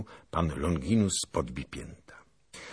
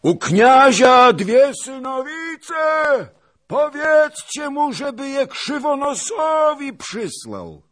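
very high, long-drawn holler; slow, powerful taunting yell; mighty shouted call; 12 dB louder, slower than usual. A narrator's reading. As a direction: call far across the field through cupped hands. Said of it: "wołał potężnym głosem".

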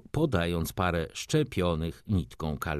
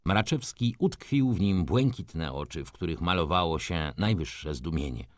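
ordinary delivery, nothing marked

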